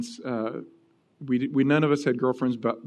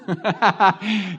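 real laugh; 4 semitones higher than usual. Real, not acted. Pastor laughing at his friend's ring story, real laughter.

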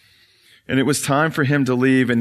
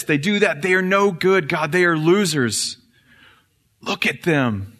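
a lightly raised, sneering accuser before God; Satan accusing; 5 semitones higher than usual. Acted. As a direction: point and accuse like the devil himself. Preacher voices Satan accusing humanity.

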